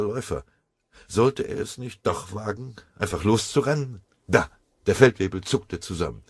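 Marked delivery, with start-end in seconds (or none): none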